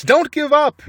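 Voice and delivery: high breathless urging; rally them, panting; urgent cry. A narrator's reading. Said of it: "panted Mister Fox".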